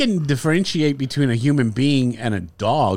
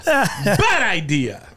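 Bad idea drawn out as a slow mock warning; 2 semitones higher, much slower than usual. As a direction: stretch Bad idea out slowly, mock solemn. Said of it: "and then I said".

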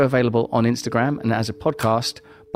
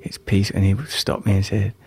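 calm, unhurried guiding voice; 4 semitones lower than usual. Acted. Meditation guide telling a story.

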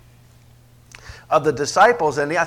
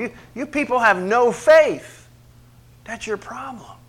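exasperated, higher and louder rebuke fading away; frustrated rebuke; 8 semitones higher, 4 dB louder than usual. Acted. Jesus rebuking faithless people, exasperated. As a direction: scold them in exasperation. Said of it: "he was frustrated with them".

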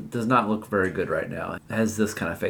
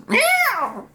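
very high yowling cat cry; high, long cartoon cat meow. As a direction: yowl like a cat in trouble. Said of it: "all of a sudden you hear this".

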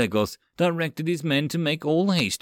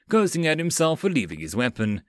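ordinary delivery

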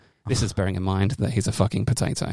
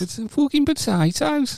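high, slow Birmingham voice; Birmingham accent; slower than usual. Acted. Joking about potatoes, high slow Birmingham accent. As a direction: drag it out in a Brummie accent.

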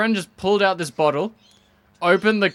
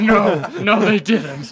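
mock shout; 4 semitones higher, 5 dB louder, slower than usual. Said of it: "I was like".

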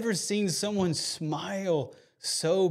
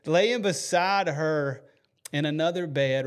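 ordinary delivery

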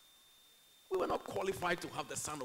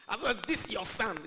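loud, higher mimicry of a scornful son; derogatory; 5 semitones higher, 9 dB louder than usual. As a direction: stress THIS with disdain. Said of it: "They talk like that".

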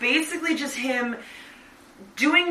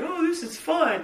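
mock Ryan; 7 semitones higher than usual. Friend mocks Ryan's enjoyment of the movie, high mock-cheerful. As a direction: parody his easy enjoyment, pitched up. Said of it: "Ryan's like".

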